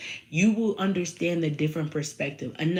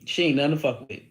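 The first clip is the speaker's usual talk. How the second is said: mock Edward boasting; 2 semitones lower than usual. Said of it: "he telling people, like".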